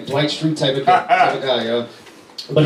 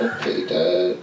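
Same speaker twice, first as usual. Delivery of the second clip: put-on sad child; 8 semitones lower, 4 dB quieter, slower than usual. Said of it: "And she's like".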